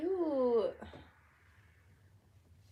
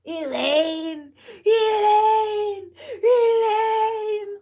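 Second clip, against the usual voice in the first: anguished scream, much higher and louder, building; screaming Elaine; 11 semitones higher, 11 dB louder, much slower than usual. Retold or imitated. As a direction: wail the name like a man dying of love. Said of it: "When he's screaming Elaine".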